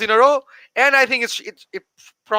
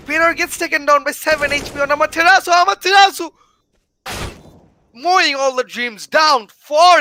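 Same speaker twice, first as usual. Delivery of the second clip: excited shout; 7 semitones higher, 4 dB louder than usual. Real, not acted. Clutch kill in grand final.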